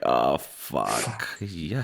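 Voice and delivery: low, soft mock resignation; resigned acceptance. Acting the father accepting the facts, low resigned voice. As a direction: drop low and quiet, giving in. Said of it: "accepts the facts, like".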